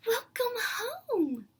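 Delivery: high, slow tearful whisper; crying. Acted. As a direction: sob out the greeting, close to tears. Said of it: "repeat of crying greeting".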